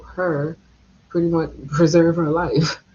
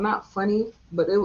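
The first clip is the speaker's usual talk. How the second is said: normal talking voice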